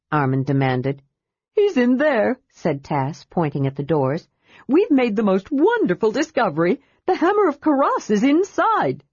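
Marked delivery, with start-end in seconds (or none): 1.5-2.4 eager kender. A narrator's reading. Tass voiced by narrator. 4.7-9.0 high, excited kender chatter; a kender bursting with news; eager kender voice. A narrator's reading. Narrator voicing Tass telling Armin of the find, eager and high.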